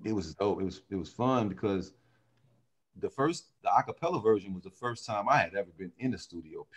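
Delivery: ordinary talk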